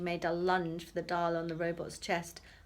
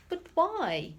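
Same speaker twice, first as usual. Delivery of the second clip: shocked demand; 10 semitones higher, 7 dB louder than usual. Acted. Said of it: "demanded his shocked mother".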